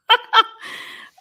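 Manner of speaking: high burst of incredulous laughter; a loud high laugh of disbelief. Real, not acted. Laughing at the accusation.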